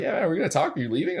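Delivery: high, loud, eager reply; eager pastor. Retold or imitated. Pastor stopping him in the parking lot, eagerly upbeat. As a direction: sound keen to catch him before he goes.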